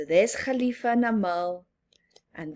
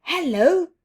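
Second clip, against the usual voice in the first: high, loud greeting; bright hello; 9 semitones higher, 9 dB louder than usual. Acted. Astronaut greeting the children, high and loud. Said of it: "says the astronaut".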